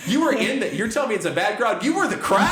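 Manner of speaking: high, loud, incredulous rant; retold angry outburst. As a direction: tear into the heckler, playing it up for laughs. Retold confrontation with a heckler.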